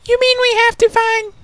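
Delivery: put-on very high little-girl squeak; tiny, high-pitched young voice; wide-eyed Pupa. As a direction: squeak it out in astonishment.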